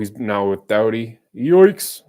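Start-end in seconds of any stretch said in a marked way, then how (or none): 1.4-2.0 yikes. Real, not acted. Reacting to the game.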